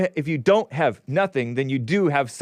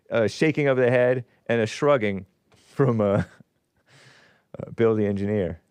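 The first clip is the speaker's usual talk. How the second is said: ordinary talk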